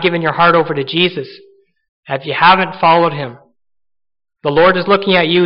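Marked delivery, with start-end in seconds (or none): none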